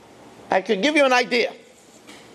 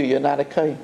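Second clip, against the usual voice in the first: hushed reveal; 9 semitones lower, faster than usual. Retold or imitated. Father's final reveal.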